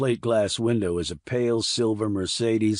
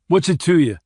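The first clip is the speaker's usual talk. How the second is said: sharp, higher, louder snap; snapped; 7 semitones higher, 7 dB louder than usual. A narrator's reading. Randy snaps at Puller over his headaches, louder and higher. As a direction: bite his head off. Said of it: "Randy snapped".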